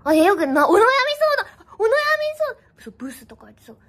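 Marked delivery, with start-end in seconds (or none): none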